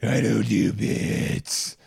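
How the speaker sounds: slow, deliberate hammed-up Batman; slow comic Batman voice; mock Batman. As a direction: drag it out like a grim vigilante. Continues the Batman bit.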